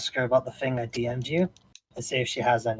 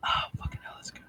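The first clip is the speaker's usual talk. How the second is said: hushed whispered curse; 12 dB quieter than usual. Real, not acted. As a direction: whisper the curse under breath. Whispered aside.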